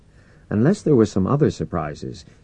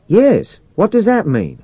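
high, raised-voice question; much higher pitch, projected for comms; radio questioning; 11 semitones higher, 6 dB louder than usual. A narrator's reading. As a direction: ask it loudly over the radio. Commander questions crewman over radio.

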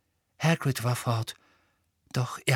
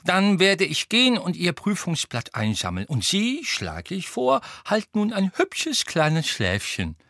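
put-on high-pitched, kindly old man; friendly old wizard; 7 semitones higher than usual. A narrator's reading. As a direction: advise the pupil in an elderly lilt, slowing at the end. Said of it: "sagte der alte Zauberer freundlich".

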